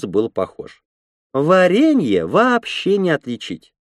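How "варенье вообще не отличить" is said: high, slow, proud woman's voice. A narrator's reading. Red-haired woman boasts of her raspberry jam, proud high voice. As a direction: boast proudly, drawing the words out. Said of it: "гордо сказала Рыжая".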